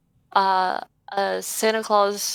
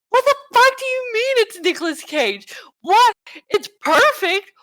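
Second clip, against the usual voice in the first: shocked disbelief; 14 semitones higher, 6 dB louder than usual. Retold or imitated. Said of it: "I'm like".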